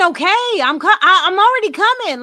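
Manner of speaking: high, loud, mock-sassy whine; hammed-up bratty teenager talking back; disrespectful teen. Host mocks how she really said it. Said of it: "you probably said a disrespectful".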